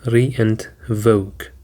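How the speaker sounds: slow, split into re and voke; syllable by syllable. Shown as a demonstration. Teacher splitting the word for learners, slow and separated. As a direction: break revoke apart slowly, re and voke. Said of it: "Revoke includes two syllables".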